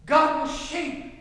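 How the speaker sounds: ordinary talk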